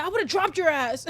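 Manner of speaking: high, loud mock tough-guy quote; hammed-up tough-guy boast. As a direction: mimic his bragging, hammed up. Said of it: "He said".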